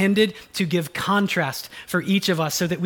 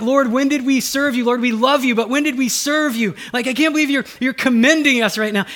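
higher, louder, amazed humble believer; humble astonishment; 7 semitones higher, 5 dB louder than usual. Acted. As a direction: marvel that the Lord commends you. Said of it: "Thinking like".

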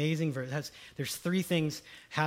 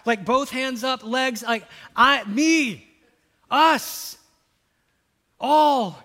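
louder, higher and building, hands up; calling out each word as if raising hands; excited raising hands; 6 semitones higher, 10 dB louder, much slower than usual. Real, not acted. Preacher excitedly calls out that everyone is included.

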